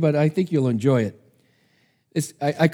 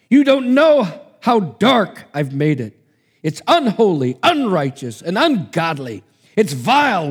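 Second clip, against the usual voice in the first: Satan's rising, louder defiance; defiant protest; 3 semitones higher, 4 dB louder than usual. Acted. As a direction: throw his claim back at God.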